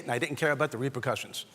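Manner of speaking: normal talking voice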